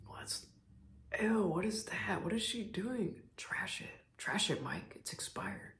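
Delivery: hushed disgusted whisper. Retold or imitated. Parents secretly snooping through daughter's kitchen, whispering. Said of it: "I just hear him whispering".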